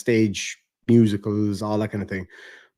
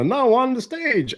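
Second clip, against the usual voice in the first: high, drawn-out announcer call; mock stage announcer, pitched high; stage introduction; 13 semitones higher than usual. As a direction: call the act up to the stage, stretching it out. Imitates being called up on stage.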